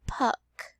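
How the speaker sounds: puck said unvoiced, breathy; whispered word. Shown as a demonstration. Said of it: "The first word that you're going to build is".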